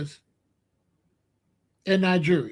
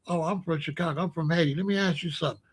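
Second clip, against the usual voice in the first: low, hushed acted exchange; confidential aside; 4 semitones lower, 8 dB quieter, faster than usual. Newcomer pulling a local aside, confidential and hushed. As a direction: drop it low, as if pulled to the side. Acting a private word pulled aside.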